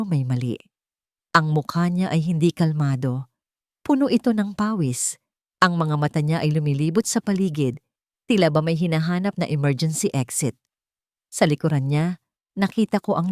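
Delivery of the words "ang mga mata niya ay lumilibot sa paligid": plain reading voice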